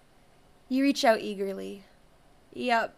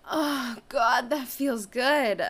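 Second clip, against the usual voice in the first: moaning; 3 semitones higher, 5 dB louder, much slower than usual. Acted. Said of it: "making me moan loudly".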